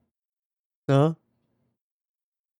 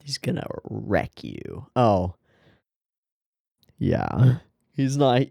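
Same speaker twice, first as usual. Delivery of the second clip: ordinary delivery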